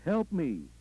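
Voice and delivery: a contained, narrated scream; screamed plea. A narrator's reading. Worshipper calling out during the sermon, mildly screamed. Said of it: "somebody screamed".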